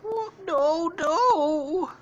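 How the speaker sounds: high-pitched mock-frightened wail; acted scared cry. Storyteller voicing scared characters, high mock-frightened cry. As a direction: wail it high like a cartoon victim. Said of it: "The children are kinda scared".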